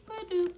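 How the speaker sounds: high bouncy vocal "ba-doop"; playful sound effect. Acted. Moving on to groceries, high playful vocal blip. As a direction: pop the transition like a cartoon blip.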